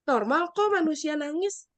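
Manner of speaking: ordinary talk, nothing marked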